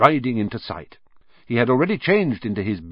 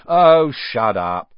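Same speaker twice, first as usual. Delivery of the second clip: irritated; 6 dB louder, much slower than usual. Acted. Percy irritated.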